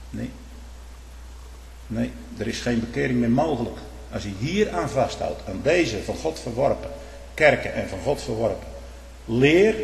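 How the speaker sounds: normal talking voice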